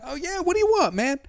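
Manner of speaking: very high-pitched, eager-to-help voice; mock eager helper. Retelling his reply to the lost Parisian, mock eager high voice. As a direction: jump way up high, playing over-friendly.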